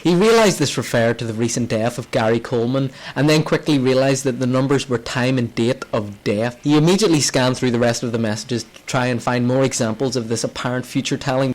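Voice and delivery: ordinary delivery, nothing marked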